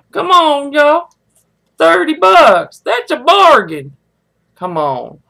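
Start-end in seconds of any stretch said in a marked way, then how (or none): 0.1-4.0 1 playful sales pitch. Pretend. Hawking the jar to family.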